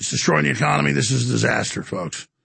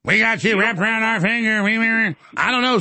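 mocking imitation; 7 semitones higher than usual. Host mocks the caller's words.

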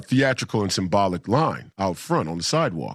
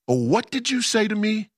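high-pitched indignant challenge; affronted retold reply; 10 semitones higher than usual. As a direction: act out taking offence, pitched way up. Acts affronted reply to delegate.

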